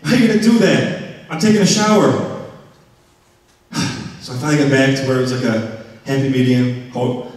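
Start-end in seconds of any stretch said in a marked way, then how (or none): 0.0-2.3 high, whiny protest at the roommate; exasperated complaint. Retold or imitated. Calling to roommate Nick from the shower, exasperated. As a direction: complain he's ruining the shower. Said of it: "I'm like".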